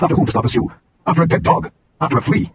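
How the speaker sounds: high-pitched rhetorical questions; incredulous challenge. A narrator's reading. David asking King Saul whom he hunts, incredulous challenge.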